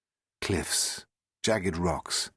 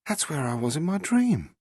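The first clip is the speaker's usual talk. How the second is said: higher, startled recognition; Rose, stunned; 8 semitones higher than usual. Acted. Rose seeing the lighthouse on the screen, stunned. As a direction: gasp it out in disbelief.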